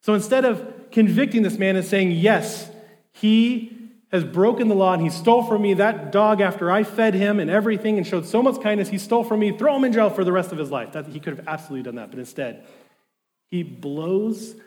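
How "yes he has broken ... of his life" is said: louder, higher, outraged demand to punish the thief; the wronged man crying out for jail; righteous anger, loud and raised; indignant accuser. Acted. Imagined bishop demanding punishment.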